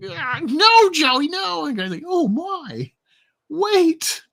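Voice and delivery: high, loud horrified pleas; panicked shout. Acted. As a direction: beg him to stop in panic. Shooting scene, characters panic.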